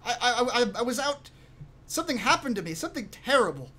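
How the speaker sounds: put-on high, stammering boy's voice; high-pitched kid, tripping over words; kid in character. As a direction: explain yourself as a rattled youngster. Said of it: "Uh, the kid says".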